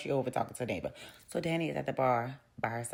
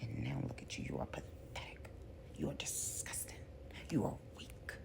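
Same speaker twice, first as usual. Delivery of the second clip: taunting whisper; 12 dB quieter than usual. Retold or imitated. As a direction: whisper the insults like a cold villain. Soap villain taunting a woman.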